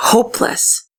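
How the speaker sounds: breathy whisper of despair. A narrator's reading. Narrator whispering the hopeless feeling. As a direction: let the word fall to a whisper.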